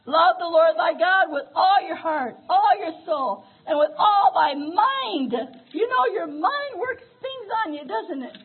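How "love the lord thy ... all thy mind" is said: loud, slow scripture proclamation; preaching the great commandment; preached scripture. Acted. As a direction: call out the verse from the pulpit.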